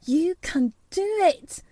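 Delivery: high-pitched, fervent urging at normal volume; will him on with rising urgency; intense self-talk. A narrator's reading. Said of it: "said Mary to herself".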